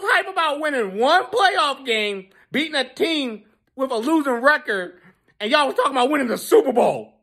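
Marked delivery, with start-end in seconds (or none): none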